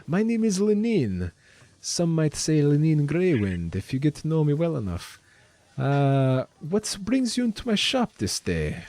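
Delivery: put-on high, lilting Russian-style shopkeeper accent. Shopkeeper introduces herself to customers, lilting Russian-style accent. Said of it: "one of my favorite accents".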